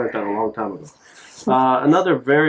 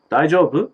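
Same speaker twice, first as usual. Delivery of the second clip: "Daijoubu" higher, rising at the end; questioning lift; 5 semitones higher, 3 dB louder than usual. Shown as a demonstration. Asking somebody sad if they are okay, rising question. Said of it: "Just add that little, at the end".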